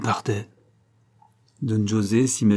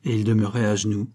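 plain reading voice

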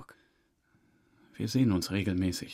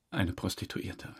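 usual speaking voice

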